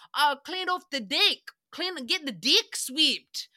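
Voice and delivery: exaggerated New Zealand or Australian vowels; Australian accent. Host mocking how deck crews say deck, broad mock Australian accent. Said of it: "if you're from Australia or New Zealand".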